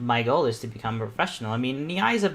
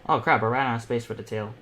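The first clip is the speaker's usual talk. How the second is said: ordinary talk, nothing marked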